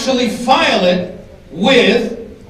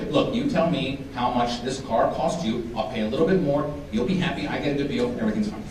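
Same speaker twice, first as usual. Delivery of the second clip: calm logical voice; 8 semitones lower, 11 dB quieter than usual. Retold or imitated. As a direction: lay it out softly and calmly. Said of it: "they bargain logically".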